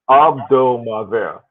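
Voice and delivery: "Abdo Maver" said slowly with a silent H; correct French pronunciation. Shown as a demonstration. Passing on a subscriber's correction to the co-host, careful correct pronunciation. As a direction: sound out "Abdo Maver", drawn out, no H. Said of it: "the correct pronunciation".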